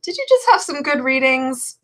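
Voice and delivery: a hint of a snarky tarot deck scolding its reader; sassy tarot deck. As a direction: tease like a smug deck of cards. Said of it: "It's like".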